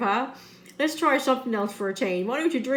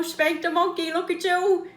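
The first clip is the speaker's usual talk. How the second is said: ordinary talk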